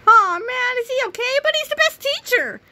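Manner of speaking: put-on high, childish kid voices; whiny students. Students groan to the substitute, high and childish. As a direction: squeal it like a disappointed kid.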